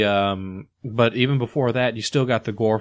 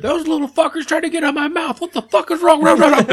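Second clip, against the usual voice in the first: high, loud panicked shriek; panicked screaming; 6 dB louder than usual. Retold or imitated. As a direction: scream in horror. Quoting an infected character.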